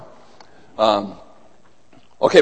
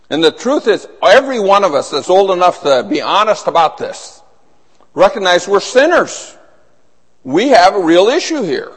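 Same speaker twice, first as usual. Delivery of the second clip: building fiery sermon, rising higher; preaching; 4 semitones higher, 4 dB louder than usual. Acted. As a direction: preach it harder and harder. Preaching builds to full force.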